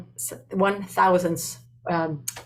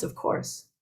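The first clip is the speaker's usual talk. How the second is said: whispered, higher gentle yes; hushed acceptance; 9 dB quieter than usual. Retold or imitated. The Buddha welcoming Angulimala, softly whispered. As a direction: breathe it out kindly, just above a whisper.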